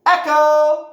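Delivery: loud, high, drawn-out canyon yell. Shown as a demonstration. Yelling from the canyon's edge, a high shout. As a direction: call it out across a canyon. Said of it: "and you yell".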